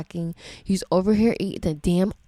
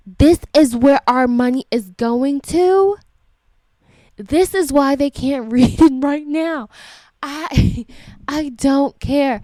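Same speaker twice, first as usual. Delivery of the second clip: raised voice, higher and louder; angry outburst; 7 semitones higher, 7 dB louder than usual. Real, not acted. Parent ranting about where school money goes, angry and loud. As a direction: rant in loud exasperation.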